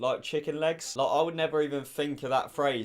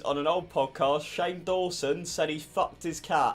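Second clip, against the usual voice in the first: ordinary talk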